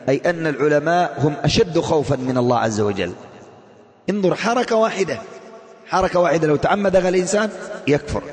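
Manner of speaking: ordinary talk, nothing marked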